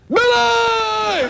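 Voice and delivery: imitated high, sudden yell of a name; startling mock shout from a passer-by; street shout. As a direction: holler it high across the street. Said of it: "people shout at me in the street".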